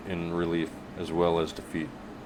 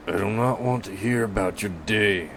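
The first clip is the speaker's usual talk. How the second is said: lightly voiced gruff grumble; grumbling; 3 semitones higher than usual. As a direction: grumble like a burly butcher. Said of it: "he grumbles".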